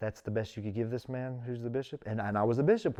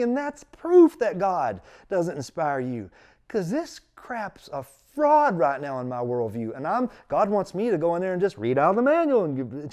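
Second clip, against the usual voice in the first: loud, raised, indignant retelling; angry rant; 6 semitones higher, 8 dB louder than usual. Retold or imitated. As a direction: rant in outrage at the absurdity. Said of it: "I got angry".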